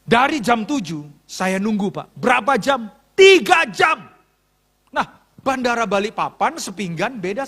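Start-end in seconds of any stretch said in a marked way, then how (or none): 3.2-4.1 high, loud shout of exasperation; outraged shout. Real, not acted. Pastor telling congregation how long he waited, outraged shout. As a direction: shout the waiting time in outrage. Outraged at three-hour wait.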